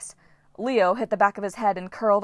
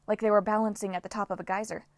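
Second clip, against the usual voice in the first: usual speaking voice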